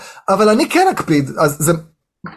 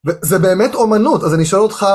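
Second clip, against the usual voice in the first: ordinary talk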